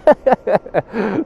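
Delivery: high rising whine, then laughter; imitated motor whine. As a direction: mimic the accelerator whine and crack up. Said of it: "I hope it picks it up".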